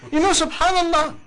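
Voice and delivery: amused exclamation breaking into laughter; laughing. Real, not acted. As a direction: say it through a real laugh. Laughs at his own point.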